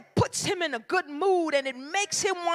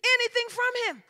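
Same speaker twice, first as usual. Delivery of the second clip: ordinary delivery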